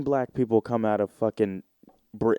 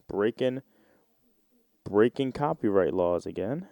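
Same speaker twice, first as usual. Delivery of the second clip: ordinary delivery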